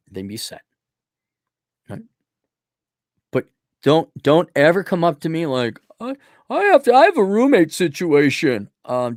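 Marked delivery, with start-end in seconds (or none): 6.0-8.7 high, hesitant mock whine; whiny caller. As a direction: stammer it like a helpless complainer.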